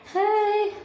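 long, high-pitched hey; drawn-out friendly hey. Pretend. Asking a friend for a small favour, drawn-out high hey. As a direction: call out a high, stretched greeting.

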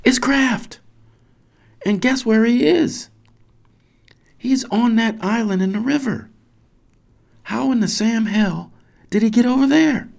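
very high, loud, astonished; amazed outburst. Acted. Parent discovers the boy on the island, amazed. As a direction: exclaim it high and loud in disbelief.

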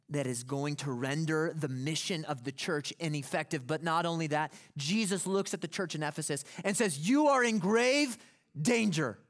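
loud, high, driving preacher's warning; preaching at full force. Acted. Pastor warning the church of grave danger, full preaching force. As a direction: build it up and hammer the warning home.

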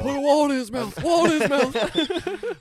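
loud, high, frantic shout; mock panic. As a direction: yell it like an emergency. Mock panic over fake seizure.

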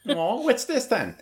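a period Australian gawking at a stranger; drawn-out cheeky Aussie question; mock Australian. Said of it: "The Australian in the time of Austen".

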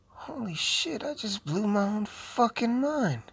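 high, breathless stoner revelation; awestruck, drawn-out disbelief; mock amazement. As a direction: gasp it high, stretching the words out. Mock amazement at own idea.

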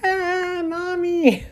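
playful imitating frightened child; mock scared kid. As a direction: squeak it like a kid running off. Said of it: "he'd go running off".

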